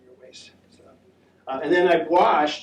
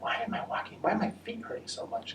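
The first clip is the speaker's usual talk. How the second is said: breathy whisper, pitched up; whispered inner thought; 7 semitones higher, 9 dB quieter than usual. Retold or imitated. Her tired self wondering why her feet hurt, whispered. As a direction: whisper it to yourself, bewildered. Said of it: "I was like".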